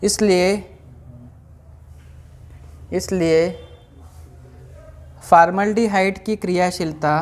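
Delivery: slow, word-by-word dictation with long pauses; classroom dictation. Acted. Teacher reading out notes for students to write, measured dictation. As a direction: dictate in small, evenly stretched chunks.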